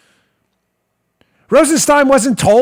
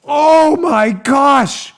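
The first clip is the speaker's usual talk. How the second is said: very slow, drawn-out hammed-up gasp of shock; mock shock; 5 semitones higher, 4 dB louder, much slower than usual. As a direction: stretch it out in fake horror. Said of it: "Here's Rosenstein".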